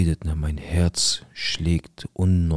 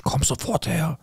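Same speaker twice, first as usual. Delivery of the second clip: high-pitched retold summons; sharp, high mock command; aggressive call; 10 semitones higher than usual. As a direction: imitate an aggressive order to come. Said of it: "So richtig aggressiv".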